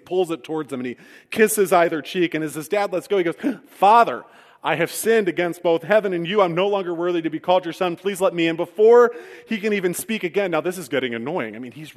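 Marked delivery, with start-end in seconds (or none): 3.7-8.6 louder, higher desperate plea of a returning son; earnest plea. Acted. Son begging his father, louder, higher pleading. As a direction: push through the speech before being cut off.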